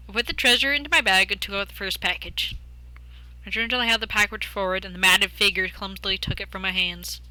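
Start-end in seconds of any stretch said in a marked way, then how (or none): none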